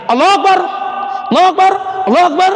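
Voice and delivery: mocking a man who prays too fast; rushed takbir. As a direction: gabble the takbir in a hurry. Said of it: "nggak sampai dua menit selesai".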